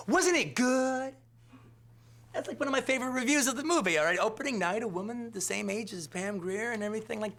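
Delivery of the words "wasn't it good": high, slow, drawn-out gush; gushing fan. Retold or imitated. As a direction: savour every word like she loved it. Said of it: "She goes".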